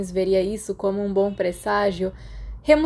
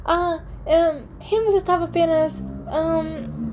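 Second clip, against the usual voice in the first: halting stammer, pitched higher; 9 semitones higher, slower than usual. Acted. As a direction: fumble for the words, caught out. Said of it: "Peter gaguejou". (gaguejando, voz mais aguda)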